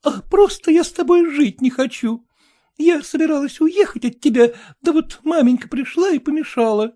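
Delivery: high, loud, tearful wife; young wife. Acted. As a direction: complain like an upset young bride. Young wife's voice held.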